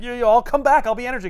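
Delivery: high, loud hurried protest; panicky Wonder Man. Retold or imitated. Wonder Man being beaten, protesting in a panic. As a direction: protest while getting hit.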